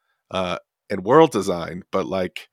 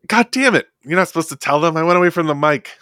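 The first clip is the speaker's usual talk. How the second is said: higher-pitched exasperated protest; mild exasperation; 6 semitones higher, faster than usual. Real, not acted. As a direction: protest at being given away to the audience. Caught out yawning off mic.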